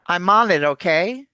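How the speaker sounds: "I'm on it, okay" run together as "I-mo-ni-tokay"; one joined phrase, "I'm on it, okay", lifting at the end; linked words. Shown as a demonstration. Coach shows linking, "I'm on it, okay" as one word. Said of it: "All those words link".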